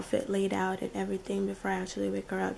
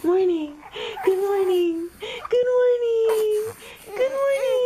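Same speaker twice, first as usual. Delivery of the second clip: high sing-song good morning; 14 semitones higher, 12 dB louder than usual. Real, not acted. Greeting baby in high voice.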